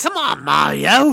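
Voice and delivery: loud New York call; New York accent. Acted. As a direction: shout it like a New Yorker.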